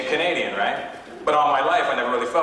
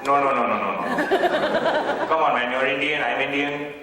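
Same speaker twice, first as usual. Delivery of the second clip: mock Indian accent, insistent; imitated Indian man's accent, slowing at the end; Indian stranger; slower than usual. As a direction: press for an answer in an Indian accent. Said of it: "Indian people give me a really hard time".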